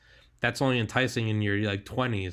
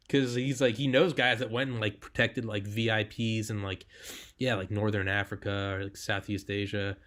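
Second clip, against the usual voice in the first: usual speaking voice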